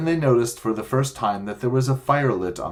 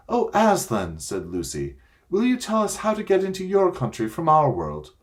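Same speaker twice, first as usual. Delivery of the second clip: put-on higher, eager little girl asking Aslan; a child's hopeful plea, pitched up; young girl pleading; 9 semitones higher than usual. A narrator's reading. Lucy begging Aslan for the way home, girlish and pleading.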